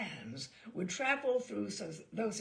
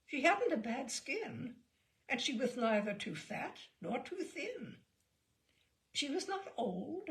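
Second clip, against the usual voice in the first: usual speaking voice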